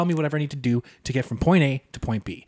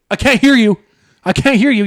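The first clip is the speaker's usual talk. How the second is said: high, loud repeated complaint; mock classmates; 7 semitones higher, 9 dB louder than usual. Classmates on a laggy call, loud and high. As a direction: call out impatiently. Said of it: "everybody's sitting there like".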